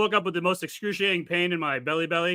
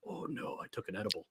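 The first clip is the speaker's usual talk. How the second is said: sheepish whisper; 9 semitones lower, 17 dB quieter than usual. Retold or imitated. Retold sheepish admission to doctors.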